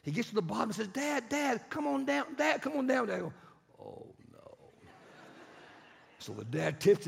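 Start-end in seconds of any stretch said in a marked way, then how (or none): 0.9-3.1 high, excited call to dad; eager, high-pitched calling; kid calling out. Retold or imitated. 3.8-4.6 hushed, breath-only dismay; sinking, whispered reluctance; dreading dad. Retold or imitated. Dad's dread at the slope.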